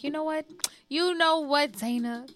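high, drawn-up mock realization; exaggerated conceding. Woman retelling giving in to her sister's advice, exaggerated conceding. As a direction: pitch it high as you theatrically give in.

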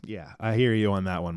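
ordinary delivery

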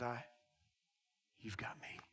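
very low, soft voice; hushed reassurance. Retold or imitated. Jesus answering the man who has nobody, hushed reassurance. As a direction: reassure him softly and low.

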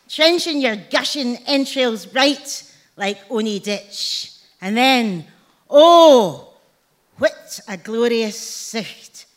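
big swells on the exclamations, falling away to savour the last words; theatrical, drawn-out Burns recitation; dramatic poem recital. Acted. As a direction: declaim it slowly with relish.

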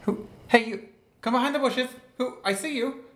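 high calling voice. Acted. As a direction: call out to someone hiding. Calling to boy in bushes.

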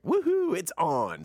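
high whooping cheer; mock cheer. Brothers cheering as the wife leaves, whooping. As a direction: whoop it up, party's on.

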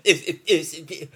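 high, loud repeated stutter; stuck on one word, frustrated; stammering. Real, not acted. Podcaster caught up in his own words, stammering. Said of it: "What is that problem where I just, like, get so caught up".

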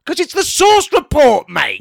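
high, loud shout of the show name; indignant shout. Real, not acted. Defending the sauce report to Paul, a loud, high shout. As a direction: bellow it in mock outrage.

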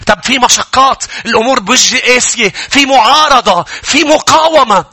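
high, rising acted complaint; impassioned objection, stretched out at the end; mounting protest. Voicing believer's protest against patience.

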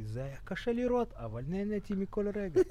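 put-on soft Russian accent in Hebrew; lightly imitating a Russian speaker of Hebrew. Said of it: "שיש לו עדיין מבטא רוסי".

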